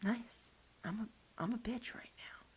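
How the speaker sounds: hushed bitter aside; whispered grumble; sulky whisper. Retold or imitated. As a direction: mutter it under your breath, jealous. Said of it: "I was just like".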